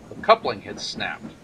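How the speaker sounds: usual speaking voice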